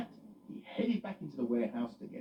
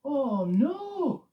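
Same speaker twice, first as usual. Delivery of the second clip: alarmed; 10 dB louder than usual. Acted. Loretta voiced.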